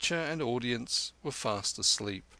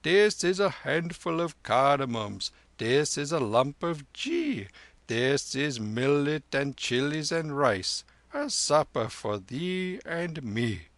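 higher, lilting, chanted lama voice; lama's nursery rhyme; 4 semitones higher, 4 dB louder than usual. Acted. Lama amusing the child with a rhyme, lilting singsong.

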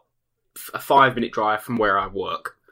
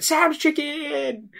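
high, slow triumphant cry; drunk friend yelling; much slower than usual. Retold or imitated. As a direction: sing out the shop name while running off. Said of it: "and she just ran out and went".

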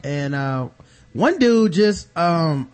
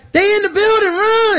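high, loud panicked warning; panicked alarm; 7 dB louder than usual. Retold or imitated. Soldier warning the camp of attackers, high and loud panic. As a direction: yell it like the enemy is already inside. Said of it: "He's like".

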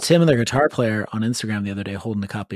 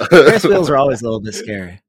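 louder, laughing through the line; 3 semitones higher, 7 dB louder than usual. Real, not acted. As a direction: giggle the words out. Laughing at the Ferris wheel story.

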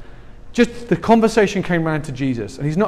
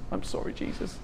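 whispered apology, far softer; guilty whisper; 15 dB quieter than usual. Pretend. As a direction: hush it like a sheepish apology. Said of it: "I'm like".

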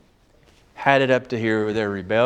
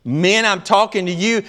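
ordinary talk, nothing marked

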